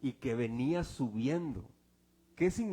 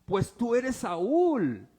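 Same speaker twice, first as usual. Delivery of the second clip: accusing cry; 7 semitones higher, 8 dB louder, much slower than usual. Retold or imitated. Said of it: "le dice la mujer".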